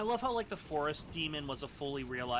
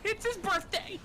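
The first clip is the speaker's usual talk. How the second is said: high, louder nasal squeal; nasally voice; 6 dB louder, slower than usual. Pretend. Joining a friend's fan role-play, nasally voice. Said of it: "I can't do the nasally voice".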